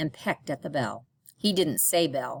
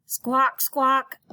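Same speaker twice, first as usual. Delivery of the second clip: high, loud squawking parrot; shrill bird call; 8 semitones higher, 5 dB louder than usual. Acted. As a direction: screech it like a parrot.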